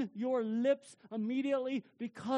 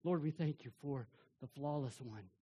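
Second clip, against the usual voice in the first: lower, hushed prayer voice; 9 semitones lower, 3 dB quieter than usual. Acted. Pastor praying for the congregation, reverent and hushed.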